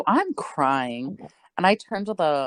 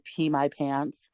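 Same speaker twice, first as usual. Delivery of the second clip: ordinary delivery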